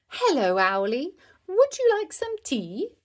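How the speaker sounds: high, loud puppet-play voice; lilting up high at the end; puppet-show host. A narrator's reading. Child voicing a puppet show.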